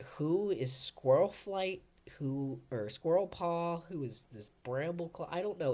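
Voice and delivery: baffled by the unfamiliar names; confused. Retold or imitated. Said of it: "And I was like".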